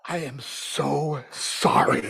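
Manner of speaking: slow whispered apology; hushed sheepish apology; sorry whisper. Retold or imitated. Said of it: "apologize from the bottom of my heart".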